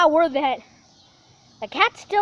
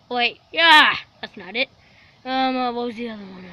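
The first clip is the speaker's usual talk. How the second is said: ordinary talk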